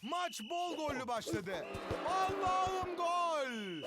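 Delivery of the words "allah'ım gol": long drawn-out goal scream; stretched goal call. Acted.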